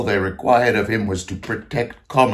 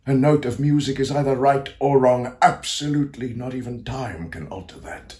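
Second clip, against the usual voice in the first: raised pitch, firm and emphatic; fervent declaration; 4 semitones higher than usual. Acted. Composer declares music's absolute truth, fervently.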